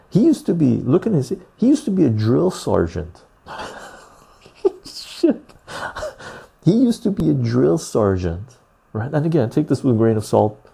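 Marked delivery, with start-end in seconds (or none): none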